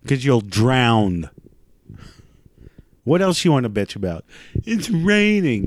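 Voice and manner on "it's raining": high, slow whiny complaint; mock whine. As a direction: drag it out like a spoiled complainer.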